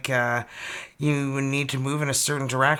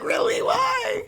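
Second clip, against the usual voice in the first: very high squeaky mock protest; mock incredulous. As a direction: squeal it in comic disbelief. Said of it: "You're like".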